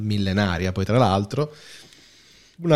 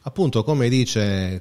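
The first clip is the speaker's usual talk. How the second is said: normal talking voice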